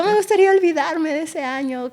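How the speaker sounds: sing-song wistful sigh of a line; mock song lament. Doctor mimics a lovesick song lyric, theatrical. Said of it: "Hay canciones que hasta dicen".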